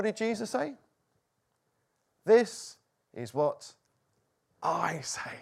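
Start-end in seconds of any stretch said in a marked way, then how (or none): 2.2-5.3 slow, weighty declaration; measured pronouncement; authoritative. Retold or imitated. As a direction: speak slowly with real authority, pausing between phrases. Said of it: "He spoke with real authority".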